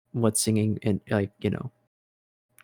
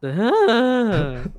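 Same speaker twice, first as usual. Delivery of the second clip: voice crack; 11 semitones higher, 6 dB louder than usual. Shown as a demonstration. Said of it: "the voice crack".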